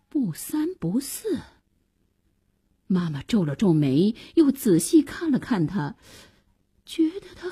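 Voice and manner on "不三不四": soft, half-whispered disapproval; muttered aside. Acted. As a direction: mutter it under your breath. Mother mutters scornfully aside.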